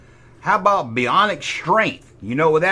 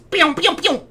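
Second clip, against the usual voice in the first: high sharp pews; laser pews; 12 semitones higher than usual. Pretend. Showing the laser beam sound, high pews. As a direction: fire laser shots with your voice.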